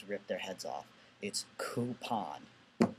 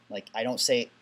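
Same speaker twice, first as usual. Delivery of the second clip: ordinary talk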